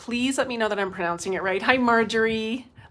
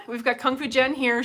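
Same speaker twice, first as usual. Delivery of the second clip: ordinary talk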